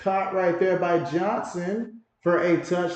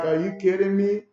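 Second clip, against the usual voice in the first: mock disbelief, a bit raised; incredulous; 4 semitones higher, 4 dB louder than usual. Lewis stunned by the call, mild mock disbelief. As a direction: play Lewis stunned by the call, half-hearted. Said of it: "and Lewis is like".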